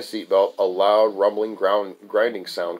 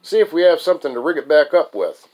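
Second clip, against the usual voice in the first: higher, louder request for help; called-out instruction; 7 semitones higher, 5 dB louder than usual. Acted. Friend asks narrator for repair material, calling out. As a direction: call over for something to fix it.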